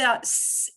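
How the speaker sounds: a hissed "s", like an English S; soft C sound. Shown as a demonstration. Teacher introducing the soft sound for C, hissed S. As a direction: hold "s" as an unvoiced hiss. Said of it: "Here's a soft sound for C".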